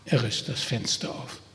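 usual speaking voice

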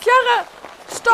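loud, high shout to stop; urgent warning. Acted. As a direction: yell to hold her back. Holding friend back. (alarmiert gerufen)

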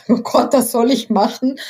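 high, startled mock horror. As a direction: squeal it in playful disbelief. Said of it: "wenn sie sagen".

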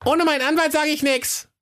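put-on very high, squeaky kid voice; high-pitched bratty stonewalling; stubborn little goat. A narrator's reading. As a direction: refuse like a cheeky goatling. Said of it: "die Geißlein sagten nur eins".

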